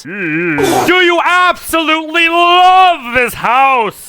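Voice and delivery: slow menacing sales pitch; sinister seller voice. Acted. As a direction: stretch it out like a predator.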